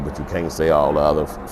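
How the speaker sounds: ordinary delivery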